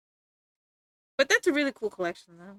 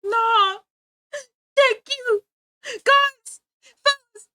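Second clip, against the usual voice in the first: high, loud, near-tearful thanks; overjoyed vindication; 8 semitones higher, 6 dB louder than usual. Real, not acted. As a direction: shout the relief, voice cracking with joy. Finally vindicated in front of chat.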